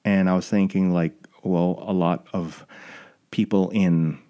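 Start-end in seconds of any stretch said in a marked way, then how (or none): none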